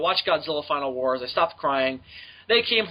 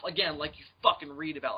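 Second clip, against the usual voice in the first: ordinary talk, nothing marked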